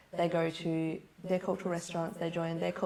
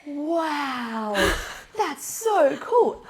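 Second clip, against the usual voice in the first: drawn-out, high-pitched imitation of amazement; amazed delight; 7 semitones higher, 8 dB louder, much slower than usual. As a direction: gush like an awestruck exchange student. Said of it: "she's like".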